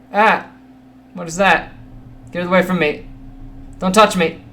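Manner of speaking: high, loud panicked yelling; phobic panic. Real, not acted. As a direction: shriek at it to stay away. Creature approaching in game.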